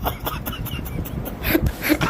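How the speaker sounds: wheezing unvoiced laugh; real laugh, can't hold it in; breathless laugh. Real, not acted. Podcaster cracking up at his own rant, wheezing laugh.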